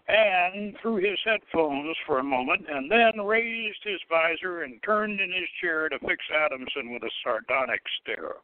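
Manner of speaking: plain reading voice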